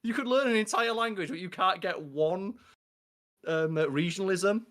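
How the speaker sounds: high, slow put-on exasperation at the ex; exasperated rant. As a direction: play it as baffled disbelief at her. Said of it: "It's like".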